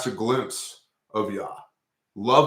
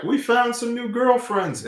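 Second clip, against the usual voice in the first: slow, much higher, silly put-on voice; mock couple's reply; 14 semitones higher than usual. The couple answering Phineas, in a slow, silly high voice. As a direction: play it like a caught couple brushing it off.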